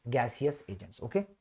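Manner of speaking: ordinary talk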